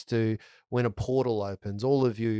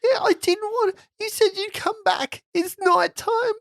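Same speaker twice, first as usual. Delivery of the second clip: put-on scared little boy; 3 dB louder than usual.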